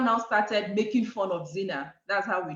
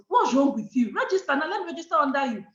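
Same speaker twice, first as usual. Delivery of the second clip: raised, high, insistent voice; scolding big sister; 5 semitones higher, 4 dB louder than usual. Retold or imitated. Big sister pushing her to register, scolding. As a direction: imitate a bossy sister pressing the point.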